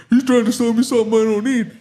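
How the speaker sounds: high-pitched customer imitation; mock suspicious customer. Shop owner voices a wary customer, mock alarm.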